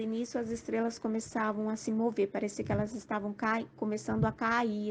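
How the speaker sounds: ordinary talk, nothing marked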